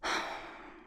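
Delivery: breathy, voiceless sigh; long exhale. Acted. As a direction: let out an audible sigh. Mock weariness in skincare roleplay.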